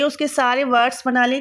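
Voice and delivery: ordinary talk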